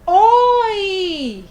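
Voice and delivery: "Oi" said loud and high like greeting a friend; a loud, happy Oi for the kids; cheerful greeting. Shown as a demonstration. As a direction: call out Oi brightly to a classmate. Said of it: "Quando é que a gente fala a palavrinha oi?".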